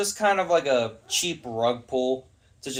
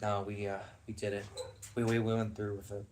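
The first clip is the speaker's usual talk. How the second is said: low, much softer, halting mumbled excuse; mock sheepish admission; 6 semitones lower, 11 dB quieter, faster than usual. Filmmakers owning up to shock value, mock sheepish. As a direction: voice them as hesitant and subdued.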